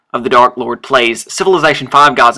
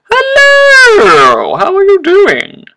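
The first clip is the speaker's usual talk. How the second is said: very high, loud falsetto hello; exaggerated greeting; 10 dB louder, much slower than usual. Player greets someone newly met in a high, loud squeal. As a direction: squeal it, sliding down from the top.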